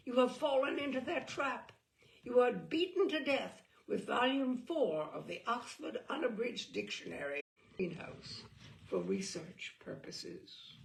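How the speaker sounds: usual speaking voice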